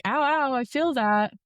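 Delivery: high, pained ows; mock yelp of pain on the table; imitated pain cry. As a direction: cry out that it hurts, retold. Feeling the surgical cut.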